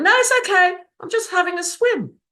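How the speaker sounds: high, loud reassuring call from the water. Retold or imitated. Floating in the sea, calling to a worried woman, high and loud. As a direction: shout up cheerfully so she won't worry.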